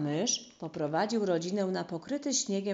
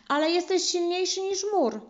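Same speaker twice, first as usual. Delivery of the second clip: quick eager interjection; 13 semitones higher, 5 dB louder than usual. A narrator's reading. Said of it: "wtrąciła szybko".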